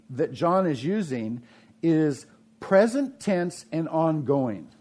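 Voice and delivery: usual speaking voice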